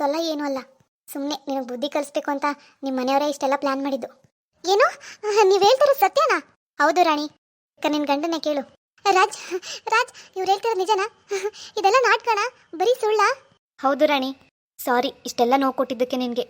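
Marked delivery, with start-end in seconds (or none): 4.6-6.4 high, loud shocked question; stunned disbelief. Acted. Rani hearing the plan was staged, shocked high cry. 9.0-13.3 shocked pleading. Acted. Rani asking Raj if it was all a lie, shaken disbelief.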